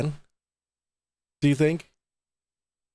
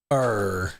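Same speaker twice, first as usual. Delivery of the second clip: low, drawn-out pirate growl; 5 semitones lower than usual. Pretend. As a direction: growl a long "arr" like a pirate. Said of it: "give him a pirate eye patch".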